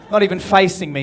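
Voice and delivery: ordinary delivery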